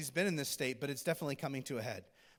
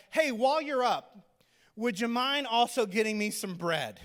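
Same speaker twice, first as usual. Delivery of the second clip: raised, higher mock-casual call; calling after someone; 5 semitones higher, 5 dB louder than usual. Prophet calls after the busy widow for bread, lightly hammed-up call. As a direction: call it out as an oblivious favor-asker.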